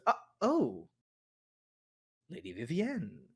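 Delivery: soft, startled recognition in character; taken aback. Acted. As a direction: realise mid-greeting it's someone else, softly. Mistook Vivienne for her mother.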